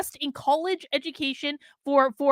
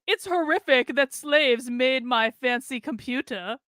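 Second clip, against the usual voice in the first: mock quote; 3 semitones lower, slower than usual. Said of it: "Ian was literally like".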